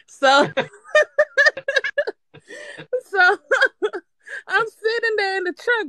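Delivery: high, half-crying laughter; can't stop laughing mid-sentence. Real, not acted. Laughing fit after scary quote.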